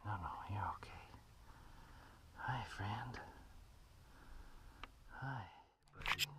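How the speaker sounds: soothing whisper to a cat. Real, not acted. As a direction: whisper gently to calm him. Calming cat in bag.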